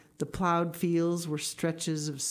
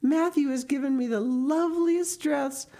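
put-on high, bubbling girl voice; jubilant Anne; 11 semitones higher, 4 dB louder than usual. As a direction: burst out with thrilling news for a best friend. Voices Anne greeting her friend.

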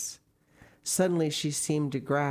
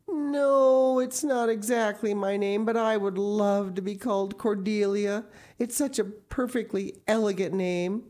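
put-on higher, louder child; lightly voiced dreamy girl; 8 semitones higher, 5 dB louder than usual. A narrator's reading. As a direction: gush over a lovely name. Anne voiced by reader.

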